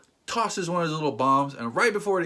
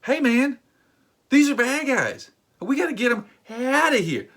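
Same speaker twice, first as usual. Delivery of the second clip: much higher, louder insistence; exasperated pleading; 9 semitones higher, 4 dB louder than usual. Pretend. Arguing with Aunt May about the bad guys, exasperated high pleading.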